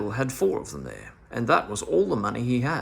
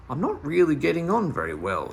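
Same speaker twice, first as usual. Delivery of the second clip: ordinary delivery, nothing marked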